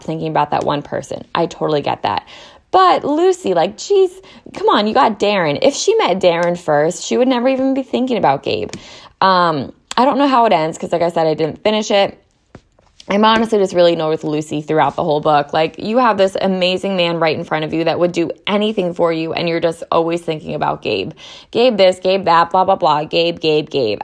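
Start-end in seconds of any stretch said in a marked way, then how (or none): 2.7-6.2 high, exasperated "But Lucy, like, geez"; pitched-up groan of disbelief. Real, not acted. Exasperated at the character. 21.5-24.0 mocking. Real, not acted. Mocking the heroine's obsession.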